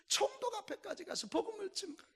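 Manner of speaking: normal talking voice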